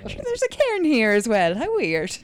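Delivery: high, loud hammed-up Irish accent; mock Irish accent. Teasing Josh about cairns, hammed-up Irish accent. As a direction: play a cheery Irish camper. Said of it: "in an Irish accent".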